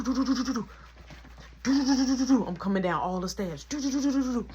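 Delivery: quick hummed do-do steps, rising; beatbox the feet running down the stairs; mimicking footsteps pounding down the stairs; rhythmic do-do running sound. Vocal sound of running downstairs.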